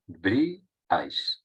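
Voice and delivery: Abríais said very slowly, stress on the í; slow model word. Shown as a demonstration. Teacher repeats Abríais slowly to show the broken triphthong. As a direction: draw out Abríais, leaning on the í. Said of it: "¿Dónde recae la intensidad?".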